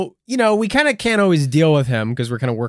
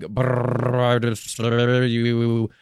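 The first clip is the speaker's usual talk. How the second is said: distorted through fan blades; much slower than usual. Acted. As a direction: voice chopped by spinning fan. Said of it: "all distorted like a fan blade".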